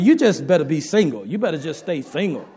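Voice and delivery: ordinary delivery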